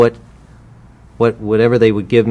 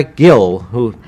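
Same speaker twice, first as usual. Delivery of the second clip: ordinary talk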